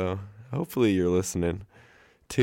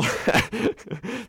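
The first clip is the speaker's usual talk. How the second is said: high burst of laughter. Real, not acted. Laughs at own rambling story.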